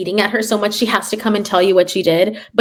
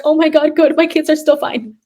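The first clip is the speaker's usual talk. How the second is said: mock relieved delight; 7 semitones higher than usual. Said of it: "I'm like".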